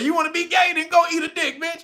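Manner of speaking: high defiant taunt with a laugh; mock president's retort. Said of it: "He said".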